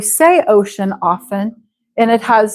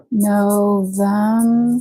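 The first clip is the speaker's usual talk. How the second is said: slow model word; 2 semitones lower, 3 dB quieter, much slower than usual. Shown as a demonstration. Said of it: "teacher models the month slowly".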